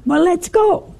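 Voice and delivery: high, eager acted quote; excited. Retelling a man eager to enter eternity, excited. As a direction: pitch it up, raring to go. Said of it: "he was so excited".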